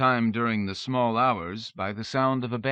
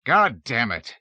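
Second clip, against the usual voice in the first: angry cry; 3 semitones higher, 7 dB louder than usual. A narrator's reading. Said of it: "he cried".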